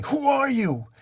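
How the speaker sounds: desperate yell. A narrator's reading. Slade crashing to the pavement with his rival, still yelling. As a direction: yell it high, demanding an answer. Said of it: "Deathstroke still yelling".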